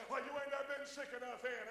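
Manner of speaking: ordinary talk, nothing marked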